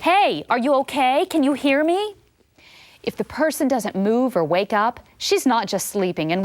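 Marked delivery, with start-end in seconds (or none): 0.0-2.1 raised, higher call to rouse someone; demo shout. Shown as a demonstration. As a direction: call out firmly to a collapsed person. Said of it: "shout something like".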